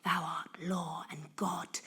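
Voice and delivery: hushed, breathless murmur like a guilty prayer; whispered prayer. A narrator's reading. A cowed crowd whispering to Anarchy, hushed prayer. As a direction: whisper it as a bowing crowd would. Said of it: "like a bad prayer not over loud, whispering".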